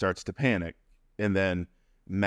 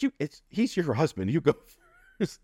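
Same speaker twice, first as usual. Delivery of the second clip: playful insisting; 8 semitones higher than usual. Retold or imitated. Pushing mom to go first.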